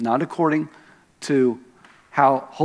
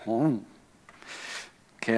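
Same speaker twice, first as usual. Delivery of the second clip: ordinary talk, nothing marked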